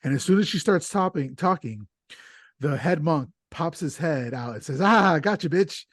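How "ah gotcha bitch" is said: higher and louder, gleeful pounce; triumphant gotcha. Acted. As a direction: snap it out like catching her red-handed. Head monk catching the woman.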